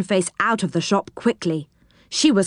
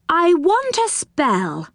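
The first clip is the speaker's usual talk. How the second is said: higher, slow polite request; Moonface asking; 9 semitones higher, slower than usual. Acted. Moonface asking the goblin for a spell. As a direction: ask carefully.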